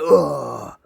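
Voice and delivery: loud acted groan of pain; old man's pained "oh". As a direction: groan out "oh" like a hurt old man. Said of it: "the old man's like".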